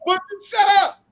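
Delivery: high snapped shut up; mock shut up. Co-host tells Booker on TV to shut up, mock angry.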